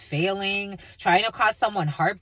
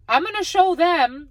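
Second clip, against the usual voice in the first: high, louder mimicked threat; mock defiance; 8 semitones higher, 5 dB louder than usual. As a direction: imitate a defiant person vowing payback. Mimics someone bent on revenge.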